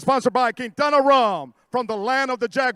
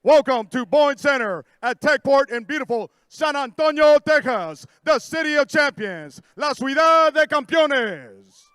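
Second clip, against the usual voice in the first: boxing ring announcer; 3 semitones higher, 5 dB louder than usual. Acted. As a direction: roll the names out like a ring announcer.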